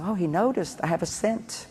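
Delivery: pleased whisper to herself; delighted wife aside. Retold or imitated. Wife noticed by husband, soft delighted murmur. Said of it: "She goes".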